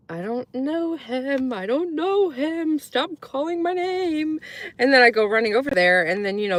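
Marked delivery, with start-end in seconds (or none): 0.1-4.4 put-on high, slow mortified child's voice; denying her dad, high and slow; embarrassed kid. Little girl embarrassed by her father, high pleading. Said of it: "And I'm just like".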